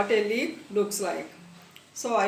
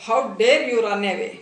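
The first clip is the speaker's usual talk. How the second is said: louder, indignant reading of an angry voice; raised-voice mild outrage; angry scream; 7 dB louder, faster than usual. A narrator's reading. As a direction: read it out loud and cross, stopping short of a scream. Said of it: "a voice screamed angrily, screamed very loudly".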